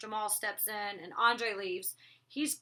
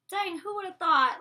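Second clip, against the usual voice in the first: loud, higher amazed exclamation; stunned, happy disbelief; amazed thought; 9 semitones higher, 8 dB louder than usual. Retold or imitated. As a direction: blurt out disbelief at yourself. Her own amazed inner thought.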